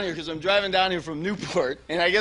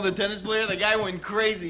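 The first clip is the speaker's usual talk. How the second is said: usual speaking voice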